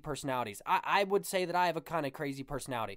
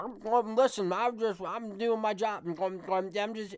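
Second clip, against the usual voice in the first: mumbled into the microphone through food; Bill Walton; 4 semitones higher than usual. Retold or imitated. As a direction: imitate talking with a mouthful, higher pitched. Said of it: "eats into the microphone and be like".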